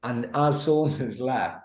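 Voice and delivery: normal talking voice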